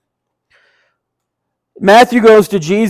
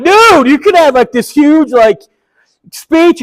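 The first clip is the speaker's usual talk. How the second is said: incredulous exclaim; 6 semitones higher than usual. Retold or imitated. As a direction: protest like he's missing the big moment. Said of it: "And I'm like".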